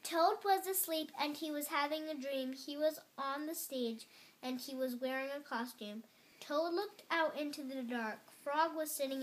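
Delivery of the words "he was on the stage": ordinary delivery, nothing marked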